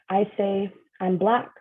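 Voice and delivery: usual speaking voice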